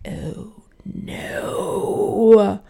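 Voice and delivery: whispered, drawn-out dawning dread; horrified whisper. Retold or imitated. Mother realising the booking error was hers, horrified whisper. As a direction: let it out as a long breathless whisper.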